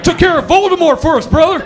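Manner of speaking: high, loud, hammed-up American bravado; brash American exchange student. Exchange student bragging about beating Voldemort, loud brash yell. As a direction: holler it proudly like a brash American. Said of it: "Just this weird American foreign exchange student".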